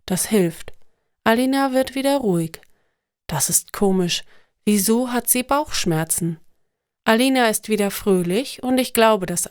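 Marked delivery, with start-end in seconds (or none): none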